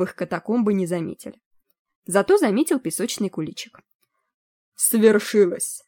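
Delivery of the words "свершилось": slow, grand proclamation; drawn-out solemn announcement; proclaiming. A narrator's reading. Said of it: "провозгласил он".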